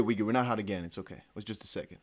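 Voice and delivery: ordinary talk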